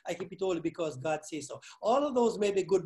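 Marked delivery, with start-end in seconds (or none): 0.0-1.6 imitating churchgoers. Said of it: "I wonder what they'll tell me".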